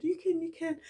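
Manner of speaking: sister's reassurance. Retold or imitated. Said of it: "She said".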